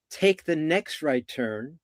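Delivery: slow, measured single instruction; unhurried in-the-moment direction; slow, clear directions. Shown as a demonstration. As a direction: guide the driver calmly, one step at a time. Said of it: "If you gave it to me very quickly".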